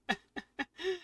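soft high-pitched giggle; real giggle, quick and high; high laugh. Real, not acted. Player amused by the game's trick, high giggle.

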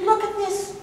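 vain bear. Acted. Bear bragging to Fox about his tail. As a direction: show off your beauty. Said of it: "And he said".

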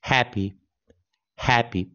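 very slow, syllable by syllable happy; slow model English. Shown as a demonstration. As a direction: say "happy" slowly, stress on hap. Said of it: "跟着老师说，happy".

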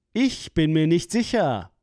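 ordinary talk, nothing marked

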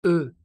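"E" said as a rounded schwa; teacher demonstrating French vowel; French vowel demo. Shown as a demonstration. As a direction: model the sound "E" cleanly. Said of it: "c'est la même prononciation".

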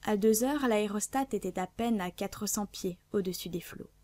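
ordinary delivery, nothing marked

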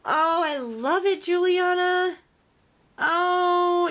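loud, high cry of delight; thrilled gushing. Real, not acted. Crafter tells friend she loves it, thrilled gushing. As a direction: exclaim it, overjoyed.

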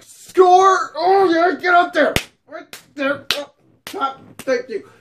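high, shouted victory whoop; triumphant celebration. Acted. Man celebrating a yes from the friend, hammed-up triumph. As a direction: crow like you've just scored.

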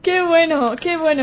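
loud, high delighted cheer; excited exclaiming. Real, not acted. Delighted by a kill.

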